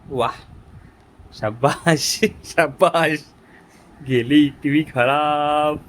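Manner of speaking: slow, drawn-out mock applause; sarcastic praise. Real, not acted. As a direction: praise the culprit with slow irony. Mock praise after child broke TV. (उपरोधिक, लांबवलेली शाबासकी)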